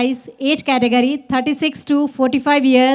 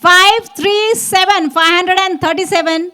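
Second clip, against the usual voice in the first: booming prize announcer; 3 semitones higher than usual. Acted. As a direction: boom out each number slowly. Announcing first prize winner. (पहले इनाम की ज़ोरदार घोषणा)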